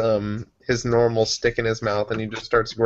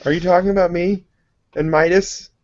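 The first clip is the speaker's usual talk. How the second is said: higher, louder, quick question; excited in character; 7 semitones higher, 5 dB louder, faster than usual. Acted. Character butting in to ask about Midas, excited. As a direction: perk up with eager surprise.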